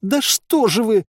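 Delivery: high, slow, dismayed cry; distraught cry. A narrator's reading. Village head reproaches her shooting fighters, distraught cry.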